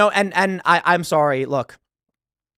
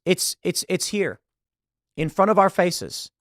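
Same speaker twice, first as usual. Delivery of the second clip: normal talking voice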